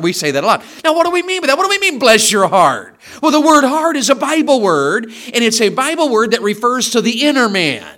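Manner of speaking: high, loud fervent preaching; preaching at full force. Acted. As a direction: build the sermon to a peak. Preaching builds.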